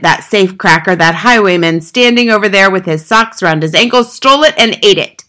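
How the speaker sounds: sustained loud, outraged accusation; Trunchbull's shouted tirade. A narrator's reading. Headmistress accuses boy of stealing her cake, shouting. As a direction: rage on at full volume, indignantly. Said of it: "the Trunchbull shouted".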